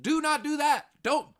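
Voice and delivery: high, loud shout of warning. Real, not acted. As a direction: yell it at them.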